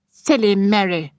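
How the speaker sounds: put-on high, chiding girlish voice; scolding sister. A narrator's reading. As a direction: tut at the silly little sister. Elder sister scolds younger.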